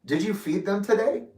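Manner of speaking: light, laughing-it-off banter; joking. Retold or imitated. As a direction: kid around, voice rising. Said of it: "it was just a joke".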